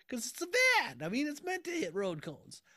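high-pitched jokey squeal of justification; mock protest. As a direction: plead the silly excuse way up high. Joking mock defence of hitting cones.

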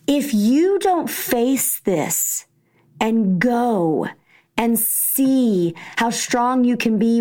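slow, deliberate encouragement; slow, earnest pep talk; slow firm urging. Retold or imitated. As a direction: press each word on him. Mom urging son firmly.

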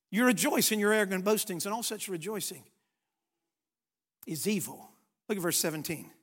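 normal talking voice

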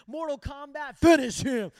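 loud mock Mortal Kombat announcer yell; retold game yell. Retelling his rage at his parents, a loud mock game-announcer shout. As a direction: bellow it like the arcade voice. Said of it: "I'm screaming and yelling".

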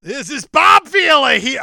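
loud pitchman announcer voice; Bob Vila as Billy Mays. Acted. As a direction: sell it like an infomercial host. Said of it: "I'm doing Bob Vila like a Billy Mays".

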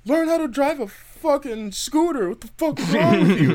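high, loud retold scolding; indignant yelling, acted out; angry mock rant. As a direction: imitate a furious cyclist telling someone off.

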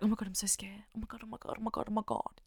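breathy fake-frightened whispering girls; mock scared whisper. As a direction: whisper it like a girl faking fright. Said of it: "pretending that they're so fucking scared".

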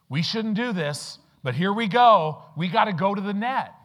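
hauling the nets in a sudden rush of excitement; excited. Retold or imitated. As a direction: fishermen scrambling for the nets, excited and loud. Fishermen hauling in the catch.

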